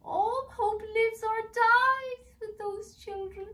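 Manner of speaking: very high, slow, loud elf declaration; solemn elf. Acted. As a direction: proclaim like a prophecy.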